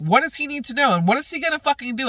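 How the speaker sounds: very high, heated questions; exasperated arguing. Retold or imitated. Retells arguing back.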